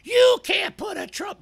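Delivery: high-pitched mock-scolding voice; mocking complainer. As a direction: imitate an objector in a squeaky falsetto. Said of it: "and he starts going".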